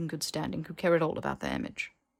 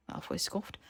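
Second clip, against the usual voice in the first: ordinary delivery, nothing marked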